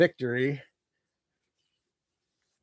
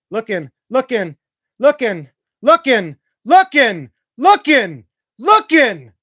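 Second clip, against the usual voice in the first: rising higher and louder with each looking; building tension; 5 semitones higher, 9 dB louder, slower than usual. Real, not acted. Fan calling the final play of the game, building excited call. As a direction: wind it up as the quarterback searches.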